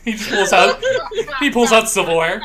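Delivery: loud, high-pitched through laughter; laughing hard. Real, not acted. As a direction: barely get the joke out for laughing. Cracking up at spooning joke.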